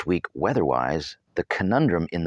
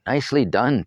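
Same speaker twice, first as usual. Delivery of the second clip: approving; 6 semitones higher, 3 dB louder than usual. A narrator's reading. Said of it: "Tarzan approved".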